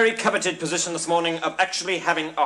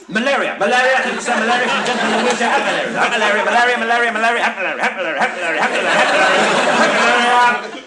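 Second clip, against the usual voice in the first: high-pitched bid-calling, building louder; excited auctioneer; 3 semitones higher, 4 dB louder, faster than usual. Acted. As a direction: chant the bids with mounting excitement. Auctioneer taking frantic bids.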